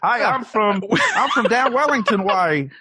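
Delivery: a broad put-on Kiwi voice; Kiwi accent. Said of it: "Welcome to New Zealand".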